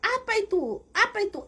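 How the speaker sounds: high, shrill-pitched startled yell. Retold or imitated. Shamans yelling at the captive's singing, high-pitched. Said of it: "mereka teriak".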